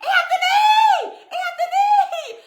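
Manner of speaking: re-enacted high, drawn-out scream for help; panicked call across the house, acted out; screaming for husband. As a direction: scream the name long and high. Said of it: "I immediately start screaming for my husband".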